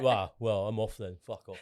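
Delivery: low, dropping dismissive grumble; mock offended customer; retold huffy exit. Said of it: "they just went".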